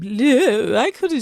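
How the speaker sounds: a hint of mid-Atlantic, pitched high; lightly voiced, badly faked mid-Atlantic accent; terrible mid-Atlantic accent. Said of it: "terrible accent".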